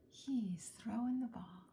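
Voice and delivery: ordinary delivery, nothing marked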